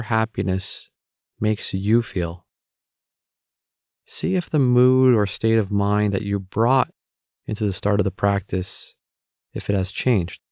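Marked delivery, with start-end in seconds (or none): none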